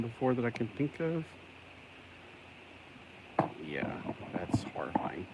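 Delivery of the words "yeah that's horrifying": soft whispered aside. Real, not acted. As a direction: breathe it out quietly. Whispers reaction.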